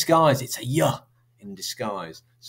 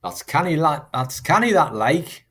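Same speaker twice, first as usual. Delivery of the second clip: high, lilting Geordie; Geordie accent; 6 semitones higher, 4 dB louder than usual. Shown as a demonstration. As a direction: put on a broad Geordie accent. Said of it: "a bit of Geordie dialect for you there".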